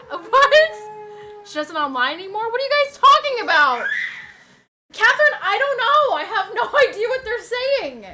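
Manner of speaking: loud, high and fast bewilderment; loud confusion. Real, not acted. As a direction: throw your hands up at the chat.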